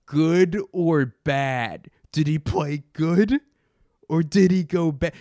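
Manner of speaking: slow, loud, dumbed-down sports host voice; mocking dumb pundit. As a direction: parody a shallow TV debate question, drawn out. Mocking shallow sports debate.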